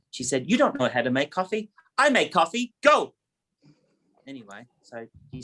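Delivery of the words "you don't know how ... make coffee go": builds from a mild scold to a high, loud order; mum scolding. Retold or imitated. Mother scolds her son and sends him away, building to a high, loud order. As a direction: shoo the son off like a bossy mother.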